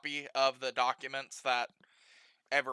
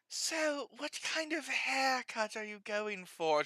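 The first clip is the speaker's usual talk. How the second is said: put-on higher, slower, soft old lady voice; 6 semitones higher, 8 dB quieter, slower than usual. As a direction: ask it slowly like a flirty cougar. GM voices Miss Willis.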